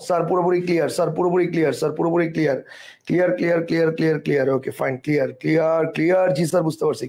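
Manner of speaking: low monotone roll-call of replies; reading out replies. Retold or imitated. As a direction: rattle off the students' answers in a low, even voice. Teacher reads out students' chat replies. (নিচু একঘেয়ে গলায় উত্তর পড়ে যাওয়া)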